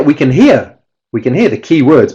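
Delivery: ordinary talk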